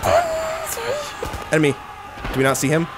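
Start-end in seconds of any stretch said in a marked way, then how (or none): 0.0-1.5 long high drawn-out battle cry; banzai yell. Real, not acted. Gamer yelling banzai as he charges in.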